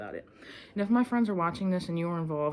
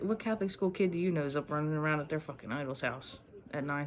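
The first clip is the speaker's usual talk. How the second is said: normal talking voice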